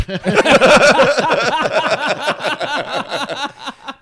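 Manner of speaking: loud high helpless cackle; real explosive laugh at the punchline; burst of laughter. Real, not acted. Cracking up at his own Pesci story, loud real laughter.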